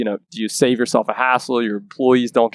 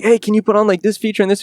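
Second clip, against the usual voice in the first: eager customer; 9 semitones higher than usual. Retold or imitated. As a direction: pitch it up like a customer asking a favor. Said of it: "they'll be like".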